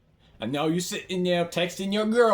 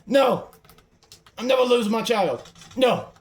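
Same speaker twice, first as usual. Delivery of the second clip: high, raised insistent yell; shouted vow; 5 semitones higher, 4 dB louder than usual. Real, not acted. Player refusing to lose the child, shouted vow. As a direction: yell it stubbornly, loud and high.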